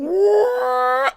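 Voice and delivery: high mock siren wail. Imitating the town storm siren wail.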